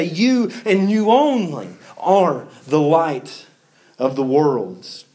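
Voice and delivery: slow, weighty stress on "You and you only"; emphatic. Shown as a demonstration. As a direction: draw it out with pauses, leaning on "only". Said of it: "you see a similar word".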